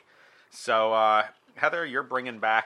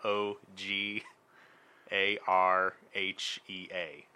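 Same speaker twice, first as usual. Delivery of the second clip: slow letter-by-letter spelling; much slower than usual. Shown as a demonstration. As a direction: spell each letter slowly. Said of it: "I got a sentence".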